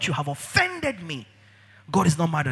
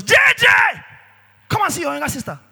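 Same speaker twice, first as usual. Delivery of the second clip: high, loud call across the house; 12 semitones higher, 6 dB louder, faster than usual. Acted. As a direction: shout for J J to come see. Calling out to Jesus.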